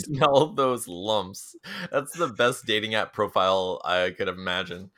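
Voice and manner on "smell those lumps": slow. Retold or imitated. Repeating the line.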